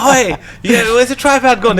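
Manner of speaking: loud, high mock heckle; teasing call-out. Husband winding up his missus over her mistake, teasing. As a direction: call out mockingly across the house.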